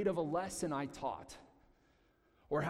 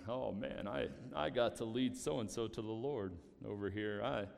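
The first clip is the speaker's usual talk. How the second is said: mild self-satisfied brag; a hint of a proud churchgoer; mock boastful voice; 3 semitones lower than usual. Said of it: "voice changing everything".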